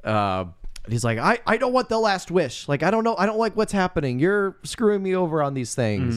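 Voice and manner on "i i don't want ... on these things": high-pitched imitated complaint; retold whiny objection; acted protest. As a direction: plead and object in a raised voice.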